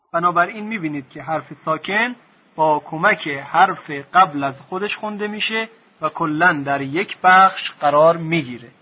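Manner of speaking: ordinary talk, nothing marked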